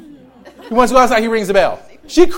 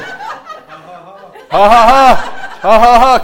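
loud laughing; 3 semitones higher, 7 dB louder, much slower than usual. Real, not acted. Preacher laughs after joke.

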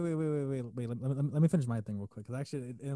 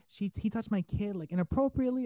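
accusing mom; 7 semitones higher than usual. Retold or imitated. Mom complaining to his manager about him, accusing. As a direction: imitate a mother lodging a scandalized accusation.

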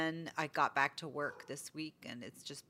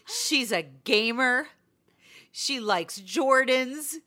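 put-on slow, higher, louder kid praising her; a boy solemnly declaring her perfect; gushing kid; 6 semitones higher, 9 dB louder, slower than usual. Boy telling Jonathan about Miss Tracy, slow and emphatic.

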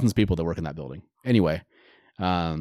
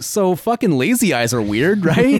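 mock excited; 8 semitones higher, 5 dB louder than usual. Mock podcast self-quote.

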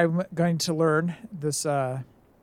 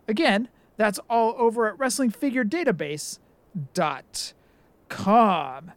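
host announcement; 5 semitones higher than usual. Acted. Host segment plug read.

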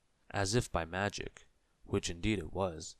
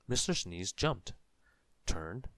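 usual speaking voice

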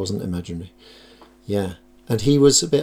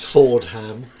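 ordinary talk

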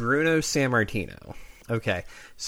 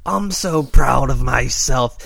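lightly voiced Macho Man, a touch louder; sneering heel gloating to the camera; Randy Savage taunt; 7 dB louder, slower than usual.

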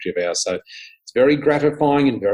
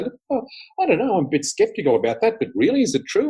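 mimicked skeptic; 10 semitones higher than usual. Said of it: "people who are skeptics".

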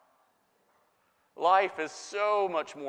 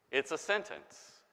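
ordinary delivery

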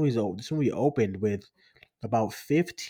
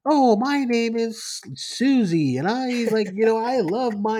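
put-on sweet dog-movie girl; 10 semitones higher, 6 dB louder than usual. Mocking the cute kid in sappy dog movies, high and sugary. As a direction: lightly parody a sugary little girl named Susie.

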